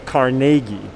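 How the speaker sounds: Carnegie stressed on the middle syllable, "car-NAY-gie"; kar-NAY-ghee, as Andrew said it; Scottish-style pronunciation. Shown as a demonstration. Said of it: "Andrew himself would have said Carnegie".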